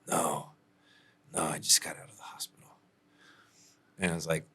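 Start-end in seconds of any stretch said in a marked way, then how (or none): 0.1-2.7 faint half-whispered voice, a touch fast; mock feeble whisper of a man faking injury; impression of T. Client faking an injury on the phone, half-whispered. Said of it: "I'll do the impression".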